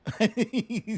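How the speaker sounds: high burst of laughter at the clip; laughing. Real, not acted. Host laughing at the Biden clip, a high laugh.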